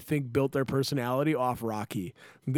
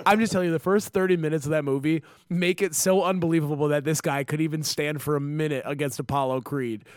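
ordinary talk

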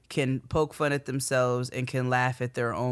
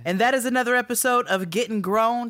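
upbeat podcast sign-off, higher; host outro; 7 semitones higher, 4 dB louder than usual. Acted. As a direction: wrap up the show like a host.

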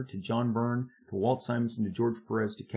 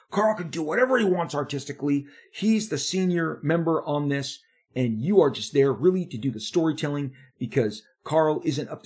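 retold yelling; 2 semitones higher, 3 dB louder than usual. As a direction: hammer it like a bullying boss. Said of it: "he screams at me".

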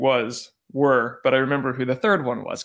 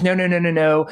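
rapid, loud string of refusals; imitating a dismissive phone brush-off; brusque refusal; 3 semitones higher, 6 dB louder than usual. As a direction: cut the caller off at once. Cutting off the caller, retold.

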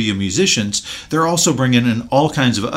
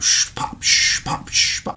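imitated rag pops; slower than usual. Telling how a kid heard the shoeshine rag, rag sounds. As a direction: mimic a shoeshine rag snapping in rhythm. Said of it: "He's like".

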